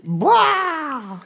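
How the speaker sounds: high mock scream of alarm; wife's panicked shriek, hammed up; imitated scream. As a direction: let out a startled wordless yell. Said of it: "my wife does this to me".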